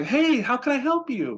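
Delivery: hammed-up perky phone voice; way too quirky. Shown as a demonstration. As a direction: overdo the cheer, too quirky. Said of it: "now I sound way too quirky".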